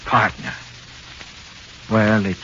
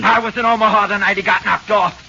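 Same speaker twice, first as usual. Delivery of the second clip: loud, much higher denial; angry denial; 11 semitones higher, 5 dB louder than usual. Acted. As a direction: shout the alibi in outrage. Suspect shouts his alibi.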